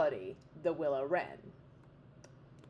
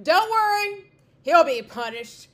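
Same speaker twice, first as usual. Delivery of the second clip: high, loud promise of revenge; king's vow; 8 semitones higher, 10 dB louder than usual. Acted. Old king promising his chicks revenge, loud vow. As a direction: swear vengeance to his children.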